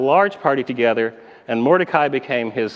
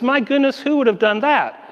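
1 mock shock; 8 semitones higher than usual. King voiced in shock.

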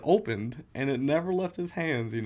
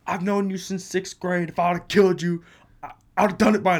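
imitating a yell, higher and louder; retold yell; 7 semitones higher, 7 dB louder than usual. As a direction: yell it at a paranoid friend. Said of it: "And I just yelled at him".

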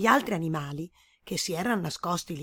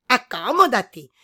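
welcoming animal voice; 4 semitones higher, 10 dB louder than usual. A narrator's reading.

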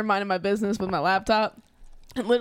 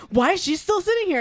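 higher-pitched mock indignation; retold outrage; 9 semitones higher, 3 dB louder than usual. Apple users confront a technician for her, retold outrage. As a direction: imitate an indignant bystander, pitch jumping up. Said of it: "curse them out".

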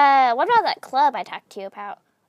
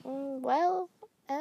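ordinary talk, nothing marked

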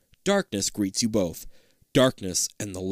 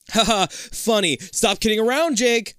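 scolding; 11 semitones higher, 4 dB louder than usual. Acted. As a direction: scold a joking friend. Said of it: "she scolds".

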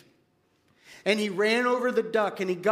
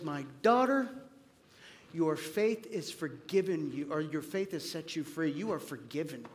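growing softer, gentle and fading to near quiet; tender voice of Jesus, softer and softer; tender forgiveness; 3 semitones lower, 4 dB quieter than usual. Acted. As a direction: forgive her gently, letting it fall away. Jesus forgiving the weeping woman.

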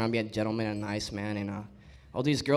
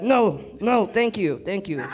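higher, emphatic repeated turning-down; mock polite refusal; 7 semitones higher, 3 dB louder, slower than usual. Declining free cocaine from strangers, higher insistent refusal. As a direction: wave it away, pitched up.

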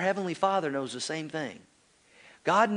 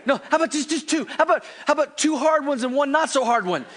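put-on high, laughing bargaining kid; negotiating child; 8 semitones higher, 3 dB louder than usual. Son bargaining over his spanking, high and laughing. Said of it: "he'd always negotiate".

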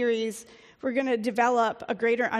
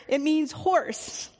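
ordinary talk, nothing marked

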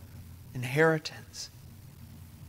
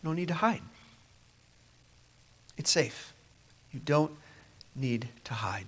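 fading to a whisper; soft reassurance; 7 dB quieter than usual. Real, not acted. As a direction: ease them gently, almost whispered. Gentle reassurance from preacher.

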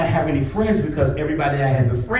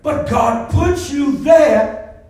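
preaching; 6 semitones higher than usual. Real, not acted. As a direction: stretch the key words for the congregation. Preaches to congregation.

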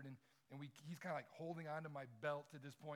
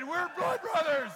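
much higher, much louder, slow laughing proclamation; triumphant declaration; 13 semitones higher, 19 dB louder, slower than usual. Retold or imitated. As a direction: proclaim it like a proud kid who just cut hands. Boy declares blood brothers, laughing.

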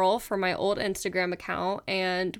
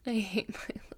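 much softer, whispered groan; mock despair; 10 dB quieter than usual. Real, not acted. Embarrassed at new account name.

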